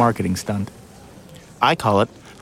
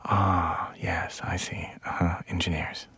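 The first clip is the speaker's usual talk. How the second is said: whisper; 4 semitones lower, 11 dB quieter than usual. Acted. NPR whisper continues.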